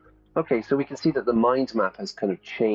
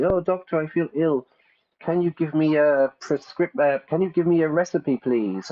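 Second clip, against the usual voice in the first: mock patient; 8 semitones higher, 4 dB louder than usual. Acted patient asking doctor.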